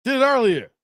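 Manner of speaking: to camera. Shown as a demonstration. Host says the requested line to the camera, lightly punched. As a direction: deliver "Did it earlier" straight to the camera, lightly punched. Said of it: "look at the camera and say".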